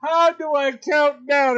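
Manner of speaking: high, loud, laughing question; laughing fluster. Real, not acted. Host fumbles the countdown, laughing and high. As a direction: laugh through the confusion.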